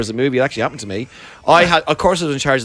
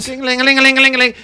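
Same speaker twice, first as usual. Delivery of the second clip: imitated bell ringing; 7 semitones higher, 4 dB louder than usual. Imitating altar bells again.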